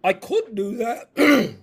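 attempted British accent, imitating a young actor. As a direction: put on a slow British lilt. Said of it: "You know, he's British".

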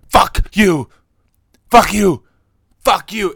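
loud repeated shouted curse; retold yelling. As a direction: bellow it like an angry customer. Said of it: "the guy just starts going".

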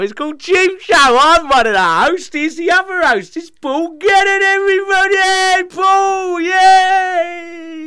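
high, loud, over-the-top host voice; silly showman voice. Pretend. Host introducing his co-host, in a silly high showman voice. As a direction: ham up the show intro, way up high. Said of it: "you really have to stop doing that fucking voice".

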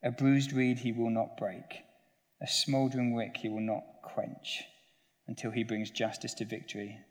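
solemn, ringing scripture read. Acted. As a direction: proclaim the prophecy to the congregation. Reads Isaiah prophecy aloud.

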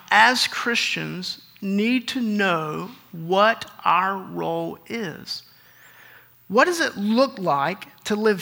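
slow, deliberate pulpit delivery; preaching the point. Acted. Pastor driving home his point to Christians, slow and earnest. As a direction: draw each phrase out for the congregation.

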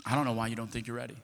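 low, soft, steady encouragement; gentle, hushed certainty; calm reassurance. Retold or imitated. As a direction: quietly assure someone they are ready. Wife's calm reassurance after his outburst.

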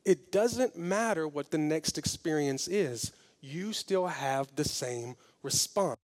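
slow, soft and low pastoral voice; calm preaching. Acted. Pastor gently urging the congregation, soft and slow. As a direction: lay it down gently like a shepherd. Quiet slow opening exhortation.

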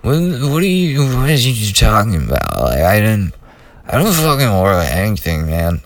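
slurred, tongue-tied wasted arguing; sloppy stoned mumbling that sinks by the end; drunken slur. Retold or imitated. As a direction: mumble it like you're half out of it. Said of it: "I'm sure it sounded like".

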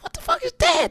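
hearing a song for the first time; high mock amazement; retold amazement. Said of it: "I was like".

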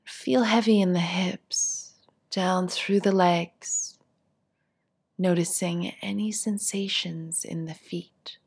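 calm, unhurried meditation voice with long pauses; guided meditation. Acted. Teacher guiding seated listeners through a body scan, guided meditation. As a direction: ease them gently through each instruction, leaving space to settle.

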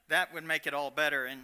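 ordinary talk

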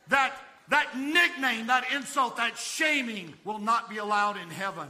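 loud, high, impassioned preacher; full-force preaching. Acted. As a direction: thunder it out to a big congregation.

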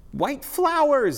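very high, loud, drawn-out shout; happy clown scream. A narrator's reading. Happy clown thinks he's got it now, high joyful scream. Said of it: "screamed the happy clown".

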